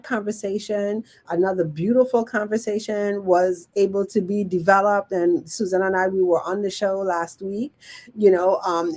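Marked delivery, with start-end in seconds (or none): none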